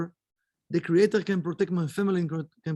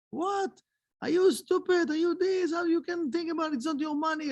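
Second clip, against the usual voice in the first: much higher, indignant retort; outraged townspeople; 10 semitones higher than usual. Retold or imitated. Townspeople cursing Rabbi Zusha for asking ten percent, outraged. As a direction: scold him in sharp disbelief. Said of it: "And they start to curse him".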